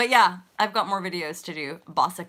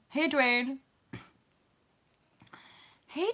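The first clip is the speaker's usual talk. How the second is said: usual speaking voice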